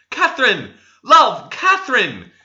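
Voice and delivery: high, loud shocked cries; a suitor stunned by his beloved's violence; shocked interjection. A narrator's reading. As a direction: cut in, horrified. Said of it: "interposed Linton, gratefully shocked".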